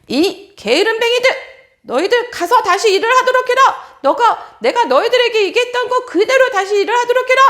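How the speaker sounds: loud, scornful tirade; furious king. Acted. King yelling at elders to go back to work. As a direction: scold them like a tyrant.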